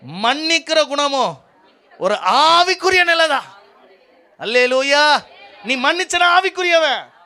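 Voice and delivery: high, loud, stretched-out proclamation; preacher at full cry, slow and ringing; full-force preaching. Real, not acted. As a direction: drive each phrase home to the congregation.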